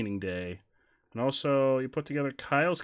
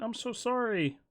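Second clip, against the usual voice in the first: usual speaking voice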